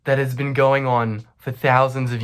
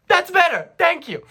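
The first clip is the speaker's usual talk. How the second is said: high, loud hammed-up satisfaction; over-the-top high-pitched retort; mock triumphant scolding; 4 dB louder than usual. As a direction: squawk it like a vindicated scold. Acted retort after she gives in.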